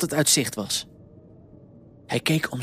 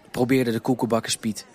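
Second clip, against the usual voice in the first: ordinary delivery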